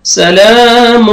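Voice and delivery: سلام slowly drawn out before a clear ha; clear ha after tanween. Shown as a demonstration. Teacher demonstrating سلام for students. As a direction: stretch the laam in سلام. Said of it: "یہاں پہ آپ نے اظہار کرنا ہے".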